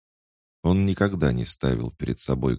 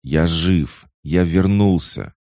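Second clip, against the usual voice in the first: urgent insistence; 5 semitones higher, 7 dB louder, slower than usual. Acted. Brother insists he is alive.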